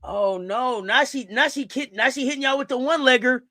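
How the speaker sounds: ordinary talk, nothing marked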